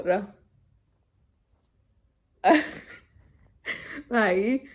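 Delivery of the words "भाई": laughing. Real, not acted. Laughs while telling.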